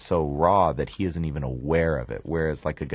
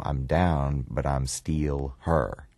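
"still" as "steal", "here" as "her", slow; a slow Memphis reading of "I'm down, but I'm still her"; Memphis accent; much slower than usual. Shown as a demonstration. Director coaching actor, slow Memphis accent demo. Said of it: "don't say still, say steal".

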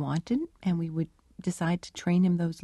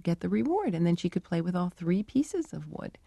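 ordinary talk

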